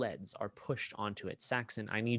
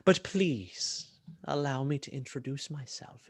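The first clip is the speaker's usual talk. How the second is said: imperious, courteous villain; 3 semitones higher than usual. Acted. Game master voices Sun Shae Soul.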